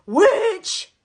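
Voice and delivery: loud, high-pitched cry of accusation; accusing shout. Retold or imitated. Psychic insisting to Liz about the hats, hammed-up accusing shout. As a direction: blurt it out at full volume, imitating an accuser.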